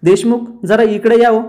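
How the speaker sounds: mild narrated call-out. A narrator's reading. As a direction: summon someone from behind. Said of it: "Kavya मागून पार्थला हाका मारते".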